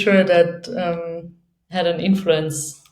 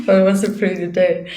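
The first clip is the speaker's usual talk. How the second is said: laughing; faster than usual. Real, not acted. Laughing after telling her day.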